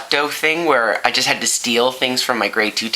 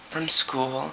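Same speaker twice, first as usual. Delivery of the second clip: small, soft guilty answer; guilty kid; 7 dB quieter than usual. Acted. Kid lying to mom about the book, guiltily soft. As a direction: answer softly, as a caught child.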